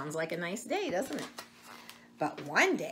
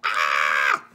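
mock scream; 10 dB louder than usual. Reader mocks scared face.

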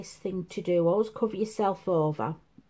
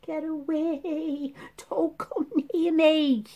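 fending everyone off; 8 semitones higher, slower than usual. Retold or imitated. To the sick family, mock fearful shriek. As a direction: shoo them away like the plague. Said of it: "I've all been like that".

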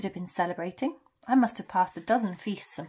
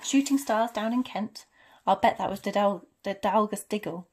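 louder, lifted scolding voice; indignant; 2 semitones higher, 6 dB louder than usual. Acted. McGonagall complains about shooting stars in Kent, indignant.

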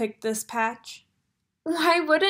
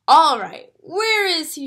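louder, demanding question; impatient girl asking; 2 semitones higher, 8 dB louder than usual. A narrator's reading. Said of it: "she asked".

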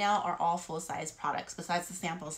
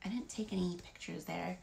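whisper; 7 dB quieter than usual. Real, not acted.

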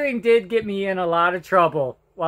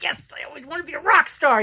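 put-on fast, higher, eager kid's yes; excited; 7 semitones higher, faster than usual. Kid told he joins the star class, excited. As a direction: blurt it out like a thrilled boy. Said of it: "I was so excited".

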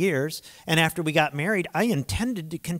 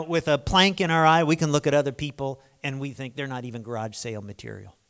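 normal talking voice

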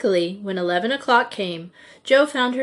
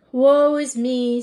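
slow, higher, louder theatrical lament; hammed-up woe; mock-tragic sigh; 5 semitones higher, 5 dB louder, slower than usual. A narrator's reading. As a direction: lament it grandly, drawn out. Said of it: "sighed Jo".

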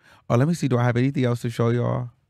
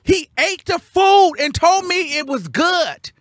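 high, loud, punched-out disbelief; indignant outrage; 13 semitones higher, 5 dB louder than usual. Real, not acted. Telling viewers he ate it and charged, outraged. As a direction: cry out the injustice word by word.

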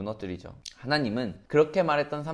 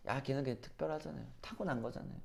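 much softer, tossed-off imitation; offhand dismissal; 14 dB quieter than usual. People writing off a champion's effort as talent, offhand dismissal. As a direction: drop the volume and shrug it off casually. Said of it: "너무 쉽게 말해버려요".

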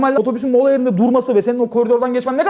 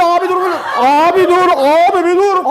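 urgent plea; 6 semitones higher, 5 dB louder than usual. Retold or imitated. Pleading with man on bus.

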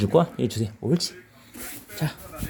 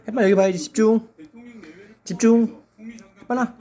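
urging the dog; 8 semitones higher, 4 dB louder, much faster than usual. Real, not acted.

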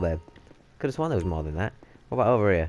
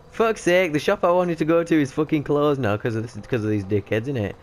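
exasperated rant, raised voice; annoyed outburst; 6 semitones higher, 4 dB louder than usual. Real, not acted. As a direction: vent at the robbers who closed the shop. Annoyed outburst at closed shop.

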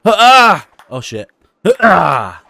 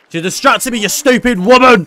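loud high playful yelling; hammed-up indignant outburst; mock angry shout; 11 semitones higher, 8 dB louder than usual. Real, not acted. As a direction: blame her loudly, half joking. Blaming viewer after miss.

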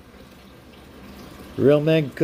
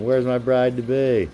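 ordinary delivery

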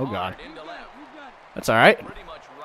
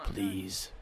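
soft plea; 3 semitones lower, 14 dB quieter than usual. Real, not acted. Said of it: "Just give me a strike".

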